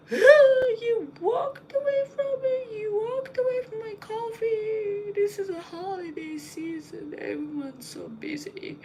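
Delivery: high, wailing mock sobs, stretched out; hammed-up grown man weeping over coffee; imitating a crying man. Grown man wailing at the barista, mock crying. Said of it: "he was really crying".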